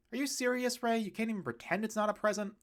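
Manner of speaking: high, fast mock outrage; family teasing Ray about his gift; exasperated ribbing.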